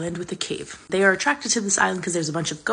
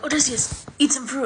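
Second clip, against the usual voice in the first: higher, coaxing like a creepy villager; 8 semitones higher than usual. Retold or imitated. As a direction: lure him in sweetly. Lotus Eaters coaxing.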